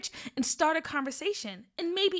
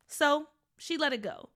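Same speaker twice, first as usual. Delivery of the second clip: usual speaking voice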